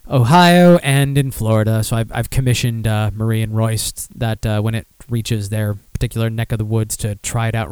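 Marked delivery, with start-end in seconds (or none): none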